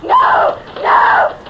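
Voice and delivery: terrified scream. Acted. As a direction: scream as it catches her. Chased by something in dark.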